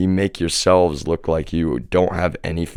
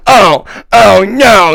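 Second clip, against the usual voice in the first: much higher, louder, drawn-out mock gasp of horror; mock shocked shriek; 12 dB louder, much slower than usual. Relatives hearing the whispered truth, mock horrified shriek. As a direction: ham up their scandalised shock, slow and shrill. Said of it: "and they're like".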